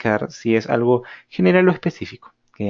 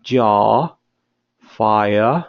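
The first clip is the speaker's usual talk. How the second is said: British open R; 4 dB louder than usual. Shown as a demonstration. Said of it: "una R un poco más británica es una R un poco más abierta".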